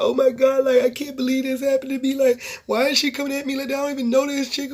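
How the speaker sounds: mocking her boo-hoo tears; imitating a crybaby playing the victim; mock victim whine. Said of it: "boo-boo crying, playing victim".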